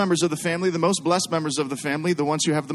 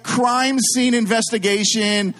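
ordinary talk

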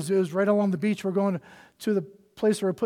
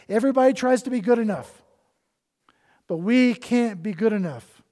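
louder, higher, fast sermon push; fervent preacher building up; preaching at full force; 3 semitones higher, 5 dB louder than usual. Acted. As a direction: drive it home from the pulpit.

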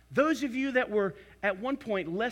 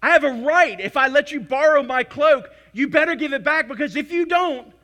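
indignant mock shout; 5 semitones higher, 11 dB louder than usual. Voicing an entitled American.

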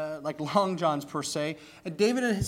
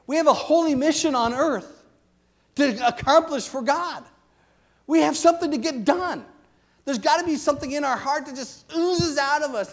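fervent preaching; 7 semitones higher, 5 dB louder than usual. Real, not acted. Preacher rising to fervent climax.

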